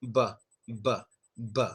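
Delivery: b, lips closed then popped open; b released with a puff; B demo. Shown as a demonstration. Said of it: "you just make the B sound".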